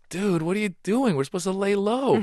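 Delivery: put-on alarmed teen. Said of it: "And I'm like".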